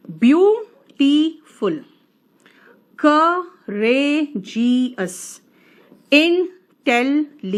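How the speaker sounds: very slow, syllables pulled apart. Shown as a demonstration. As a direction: sound out each syllable for students. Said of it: "जितने शब्द खंड होते हैं ना". (शब्द खंड अलग-अलग करके धीरे)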